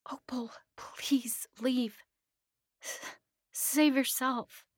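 slow, high, strained whisper; pleading whisper. A narrator's reading. Captive friend begging Opal to leave, whispered. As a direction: whisper it haltingly, urging escape. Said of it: "she whispered to me".

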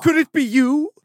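rising high singsong; teasing singsong. Acted. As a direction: tease the crowd, pitch climbing. Teasing host builds suspense.

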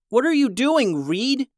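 high-pitched, startled question; alarmed demand. A narrator's reading. Harvey confronts his shapeshifting brother, alarmed.

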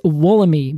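"Wollemi" ending in a long "ee"; American pronunciation. Shown as a demonstration. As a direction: read "Wollemi" as spelled, last syllable "mee". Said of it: "our pronunciation is".

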